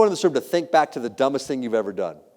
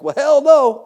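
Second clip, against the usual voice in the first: much higher, loud indignant "hell no"; mock students' outcry; 13 semitones higher, 11 dB louder, slower than usual. College students shout back at the teacher, loud and indignant. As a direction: blurt it out in outraged disbelief.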